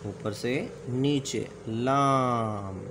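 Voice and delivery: लाम drawn out very slowly; stretched letter name. Shown as a demonstration. Teacher to students, slowly stretched letter name लाम. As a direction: hold the vowel of लाम long, like teaching a child. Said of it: "teacher sounding out letter".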